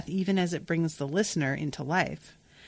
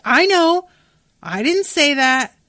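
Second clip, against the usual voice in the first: loud and higher, defensive; defensive snap; 8 semitones higher, 8 dB louder than usual. Acted. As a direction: bite back at the accusation. Liriel snaps back defensively.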